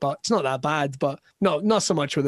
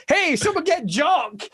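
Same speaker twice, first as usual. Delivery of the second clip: higher, slow call across the room; 11 semitones higher, slower than usual. Retold or imitated. Canadian host calling for Jock, higher and drawn out. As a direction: shout for Jock across the bar. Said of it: "we've got a guy here".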